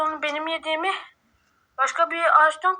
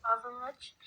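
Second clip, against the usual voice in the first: soft unvoiced whisper; 3 semitones lower, 12 dB quieter than usual. Real, not acted. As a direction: whisper it to him. Repeats command, then whispers.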